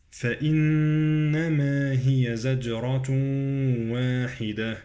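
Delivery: slow recitation, bouncing j in "زجرة"; qalqalah and idgham. Shown as a demonstration. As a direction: echo the j, hum "زجرة" into "واحدة". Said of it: "قلقلة الجيم وإدغام".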